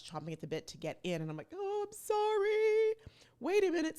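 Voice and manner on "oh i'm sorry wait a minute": drawn-out, high-pitched faux-sweet sorry; mock apology. Creator to customers chomping to get in, high mock apology. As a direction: sing out a loud, exaggerated apology.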